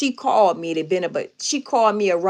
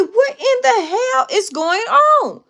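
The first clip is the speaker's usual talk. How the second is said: very high, drawn-out incredulous outcry; alarmed demand; 14 semitones higher, 4 dB louder, slower than usual. Retold or imitated. Aunt hearing hollering on a late-night call, high alarmed demand. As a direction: re-enact startled disbelief, pitched way up.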